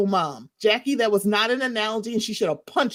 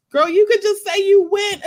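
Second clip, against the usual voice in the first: exasperated callout; 11 semitones higher, 7 dB louder than usual. Real, not acted. Exasperated call-out at Larsa.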